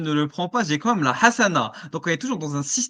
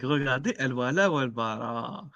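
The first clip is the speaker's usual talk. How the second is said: unhinged villain sneer, lightly voiced; diabolical grin; 4 semitones lower, 5 dB quieter than usual. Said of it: "un sourire diabolique".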